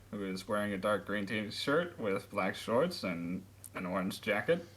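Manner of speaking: ordinary delivery, nothing marked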